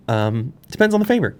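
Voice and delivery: normal talking voice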